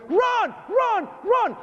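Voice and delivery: very high yelled command to run; shouted warning. Retold or imitated. Police shouting as the tower rumbles, high urgent yell. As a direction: yell to get people out of the way. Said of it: "I hear".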